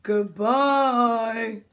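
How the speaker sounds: lazy, lingering call; slow character calling out. Acted. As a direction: draw the word right out. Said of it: "He'll shout out".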